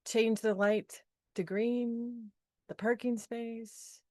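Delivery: ordinary talk, nothing marked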